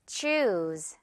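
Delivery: "Choose" low, long oo into z; long "oo", final z. Shown as a demonstration. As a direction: Choose drawn out and ending on z. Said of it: "마지막에는 Z 발음 처리로".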